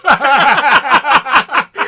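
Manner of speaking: loud, high whooping laugh; howling with real laughter; big burst of laughter. Real, not acted. Huge laugh at mother's trick.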